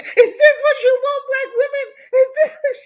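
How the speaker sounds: high, loud mock rant through laughter; mocking angry ranter. Streamer mimics the mad man's rant, high and loud through laughter. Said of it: "He's big mad".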